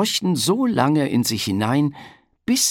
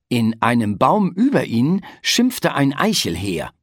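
usual speaking voice

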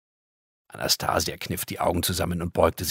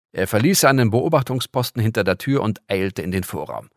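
usual speaking voice

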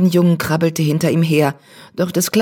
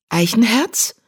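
Name mit steigender Frage gerufen; „Eichenherz?“ hoch ansteigend, fragend; fragend überrascht; 5 semitones higher than usual. A narrator's reading. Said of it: "miaute die Katze". (überrascht nach dem Kater rufend)

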